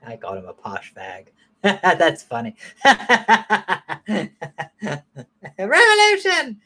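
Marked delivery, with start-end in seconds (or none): 2.8-5.2 giggling at his own joke; helpless high laugh; real laughter. Real, not acted. 5.6-6.6 very high-pitched droid cry; mock droid exclaiming, very high; C-3PO voice. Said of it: "what he says the whole fucking time".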